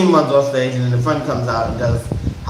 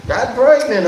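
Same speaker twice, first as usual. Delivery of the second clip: sassy exclaim; 9 semitones higher, slower than usual. Real, not acted. Gossip echo, high exclaim.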